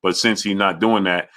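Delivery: normal talking voice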